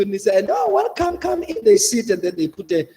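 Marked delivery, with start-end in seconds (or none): 0.5-1.6 high, eager welcome, much higher; welcoming. Retold or imitated. Host welcoming the visitors in, high and eager. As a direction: invite them in with a lifted, eager voice.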